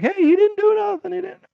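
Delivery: high-pitched crowd voice; protesting bystanders. Retold or imitated. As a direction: imitate onlookers butting in to defend him. Bystanders defending him to cop.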